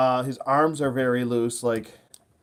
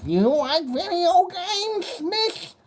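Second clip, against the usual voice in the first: very high, slow nerd voice; drawn-out geeky come-on; nerdy falsetto; much slower than usual. Pretend. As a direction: nerd asking a woman about video games. Nerd approaching a woman.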